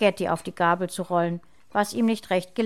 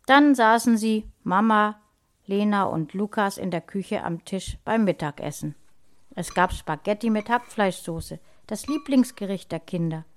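plain reading voice

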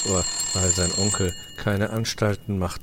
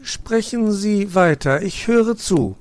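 put-on high, louder attentive boy; polite young fox; 13 semitones higher, 8 dB louder than usual. Nephew speaks to magpie, polite boy voice. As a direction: invite her to go on. Weißohr on phone.

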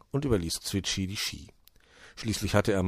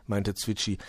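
ordinary delivery, nothing marked

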